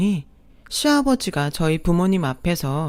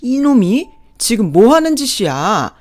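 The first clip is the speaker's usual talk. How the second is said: put-on louder, bellowing old man rage; roaring father-in-law; 2 semitones higher, 5 dB louder than usual. Father-in-law roaring at his wife and son, enraged.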